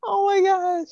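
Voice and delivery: high, loud delighted squeal; squealing oh my gosh. Real, not acted. Cohost squeals at her friend's tweet, delighted squeal. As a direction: shriek it out, thrilled and half crying with laughter.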